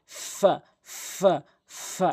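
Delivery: a clear friction f in each "ফা"; lip-teeth F. Shown as a demonstration. Teacher repeating for learners, teeth-on-lip "ফা". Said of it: "উপরের দাঁতগুলো নিচের ঠোঁটে".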